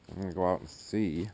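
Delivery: ordinary talk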